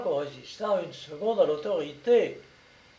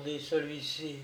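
usual speaking voice

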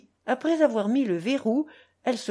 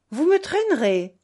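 higher, louder incredulous retort; indignant challenge; 5 semitones higher, 6 dB louder than usual. A narrator's reading. Mother rising to face grandfather's threat, indignant. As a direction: throw the threat back at him.

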